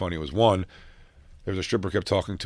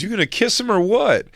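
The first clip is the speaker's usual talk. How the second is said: much higher, impatient acted challenge; movie character imitation; 12 semitones higher, 3 dB louder than usual. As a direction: push for an answer in a high mock voice. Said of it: "no, he goes".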